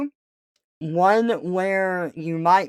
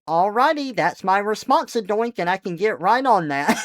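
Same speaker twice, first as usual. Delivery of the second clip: high, loud, eager service voice; mock cheerful Stacy; 5 semitones higher, 5 dB louder than usual. Playing Stacy agreeing to oink, high and eager. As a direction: chirp it like a too-willing worker. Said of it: "she's like".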